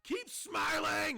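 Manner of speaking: high, slow, furious screamed command; screaming. Acted. Baron screaming at the crowd to keep smiling, furious shout. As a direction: bellow it high and drawn out. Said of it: "he starts screaming".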